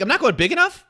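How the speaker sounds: hammed-up shocked repeat, rising at the end; incredulous echo. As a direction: throw the words back, stunned.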